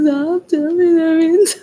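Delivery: high, loud, pretend crying complaint; mock tearful plea. Complaining to the neighbors, pretending to be near tears. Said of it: "I'm about to start crying to my neighbors".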